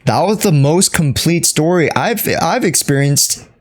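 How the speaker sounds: normal talking voice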